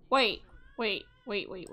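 ordinary talk

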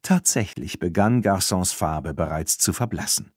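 ordinary delivery

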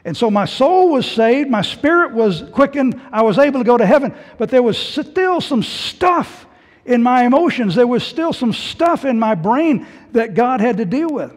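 loud, high preacher's build, slowing on the last lines; fervent pulpit delivery rising in pitch and volume; preaching at full force. Real, not acted. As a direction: preach it out to the hall.

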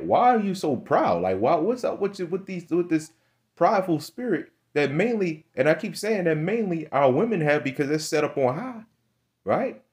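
high, rising incredulous preaching; heated preaching. Real, not acted. Preacher demanding why people are so proud, high and heated. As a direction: press them on their pride.

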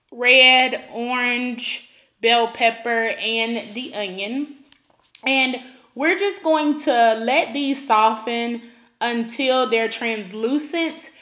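normal talking voice

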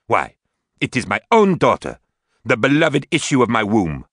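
loud, high, bitter accusation; shouting. Acted. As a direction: hurl it at her daughter. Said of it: "She was now shouting".

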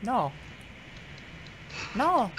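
high, drawn-out maid's refusal; Consuela. Acted. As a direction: stretch out a high, stubborn "no". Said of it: "can I do the Consuela voice".

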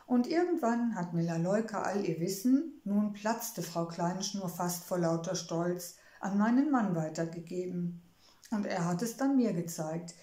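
usual speaking voice

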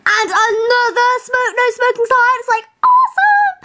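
high, rising mock thrill; mock excitement. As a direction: fake wild excitement about a sign. Mock excitement about sign.

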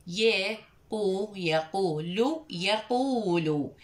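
very slow يقول with each syllable drawn out; slow, careful reading; slow teaching voice. Shown as a demonstration. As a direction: spell يقول out slowly for the class. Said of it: "كلمة يقول".

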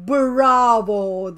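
lightly voiced friend yelling; mock shout. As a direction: shout bravo on her behalf.

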